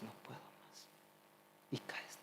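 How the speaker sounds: a spent, whispered surrender; defeated whisper. Retold or imitated. Addict at the end of his strength, whispered defeat. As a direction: breathe it out, voice gone, giving up. Giving up in exhaustion.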